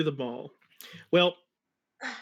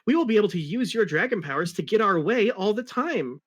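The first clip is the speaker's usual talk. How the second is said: higher, awestruck near-tears gush; lightly voiced amazed sidekick; a hint of tearful wonder, voiced high; amazed Tails; 6 semitones higher than usual. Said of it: "almost crying with amazed feelings".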